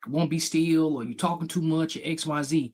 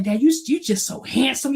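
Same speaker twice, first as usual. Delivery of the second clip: put-on kid flattering dad; 10 semitones higher than usual. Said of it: "I was like".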